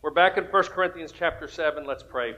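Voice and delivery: usual speaking voice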